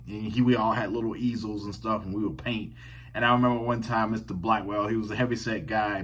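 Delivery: ordinary talk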